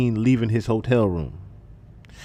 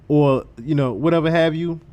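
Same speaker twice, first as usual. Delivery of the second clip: ordinary talk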